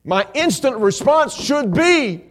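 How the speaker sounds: high, loud, slow preaching shout; full-force pulpit cry, drawn out. Real, not acted. Preacher to congregation on the right response, full-force shout.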